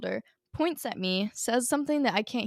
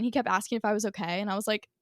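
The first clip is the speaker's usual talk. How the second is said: normal talking voice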